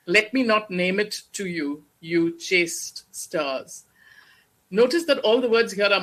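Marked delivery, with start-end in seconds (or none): 0.1-3.8 slow Othello recital. Shown as a demonstration.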